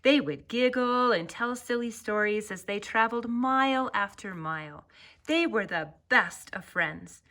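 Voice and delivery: plain reading voice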